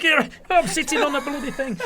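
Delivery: very high Lancashire punchline voice; Lancashire accent. Retold or imitated. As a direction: squeeze out the joke in high-pitched Lancashire. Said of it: "So it's my Lancashire accent there".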